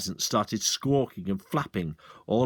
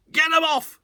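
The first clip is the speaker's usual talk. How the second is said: much higher, louder scream; 6 dB louder than usual. Acted. Landowner screaming as birds land on his car. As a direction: panic for the precious car. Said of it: "screamed Mister Hazel".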